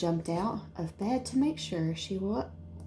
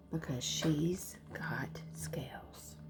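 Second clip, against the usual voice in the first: slow hushed whisper; 6 semitones lower, 10 dB quieter, slower than usual. Acted. Fairy confides in Jaya in a slow whisper. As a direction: let it out like a secret. Spooky aside about dragon.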